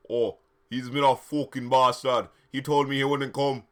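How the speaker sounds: lightly put-on Jamaican accent; a hint of a Jamaican voice, complaining. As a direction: play Vampire with a Jamaican lilt. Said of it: "I gave him a Jamaican voice".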